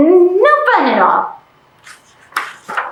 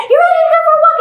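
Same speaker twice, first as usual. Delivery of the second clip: excited; 13 semitones higher, much faster than usual. Retold or imitated. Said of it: "I said".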